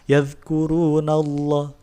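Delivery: Yadhkurunallah with a thick, full-mouthed lam; slow chanted Yadhkurunallah, lam heavy. Shown as a demonstration. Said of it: "Lam tebal itu".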